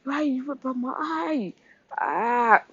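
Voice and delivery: high, drawn-out wail, kept soft; mock pained scream. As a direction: act out screaming in pain without volume. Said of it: "Sludge was screaming".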